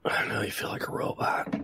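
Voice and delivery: hushed unvoiced whisper. Real, not acted. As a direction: breathe it out in a whisper. Whispered tease.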